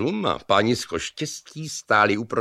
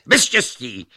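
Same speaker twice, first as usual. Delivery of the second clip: louder, higher insistence; escalating bickering; 5 semitones higher, 6 dB louder than usual. A narrator's reading. Luck contradicts Reason, heatedly louder. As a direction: top the other person's volume.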